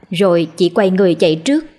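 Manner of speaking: plain reading voice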